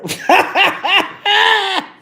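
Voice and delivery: high, loud burst of laughter; laughing hard. Real, not acted. Recalling the cheeky message to viewers, cracking up. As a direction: burst out cackling at your own joke.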